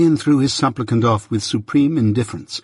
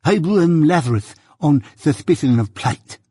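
eager lisp, a bit higher; fawning petitioner pressing his case; lisping suitor; 4 semitones higher, 3 dB louder than usual. A narrator's reading. Suitor pleading his cousin's case to Jaggers, lisping.

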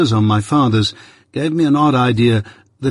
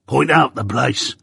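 gruff convict. Acted. Convict demands.

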